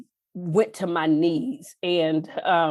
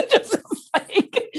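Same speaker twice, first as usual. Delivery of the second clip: high laugh spilling into words; real laugh; 4 dB louder than usual. Real, not acted. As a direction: let the laugh carry the words. Laughs after mother's quote.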